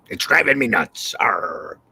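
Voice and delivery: lightly growled pirate. Pretend. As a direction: say it like a cartoon pirate. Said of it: "do my pirate voice".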